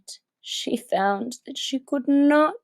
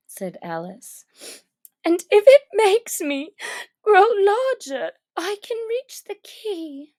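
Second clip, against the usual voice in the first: put-on much higher girl's voice, eager; Alice reasoning; 7 semitones higher than usual. Alice deciding to eat the cake, eager reasoning. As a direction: work it out brightly like a child.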